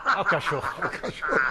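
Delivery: laughing while teasing. Real, not acted. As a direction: giggle through the words. Teasing the rival's fan, laughing. (rindo e provocando)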